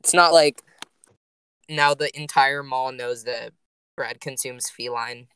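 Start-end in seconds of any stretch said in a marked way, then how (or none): 3.9-5.3 slow, quiet and matter-of-fact; nonchalant. Real, not acted. Deadpanning an absurd joke about the cat-eating rumor, nonchalant. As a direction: toss it off calmly, drawing it out softly. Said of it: "said it the most nonchalant way".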